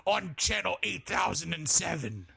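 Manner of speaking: lightly voiced pirate; a hint of a playful pirate. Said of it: "talking like a fucking pirate".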